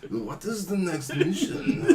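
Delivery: slow, high mock Japanese accent; hammed-up Japanese game-character voice; Toshi. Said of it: "you're just all like".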